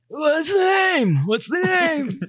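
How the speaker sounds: put-on high, slow old clerk voice; very old man. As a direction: drag it out like a frail old man.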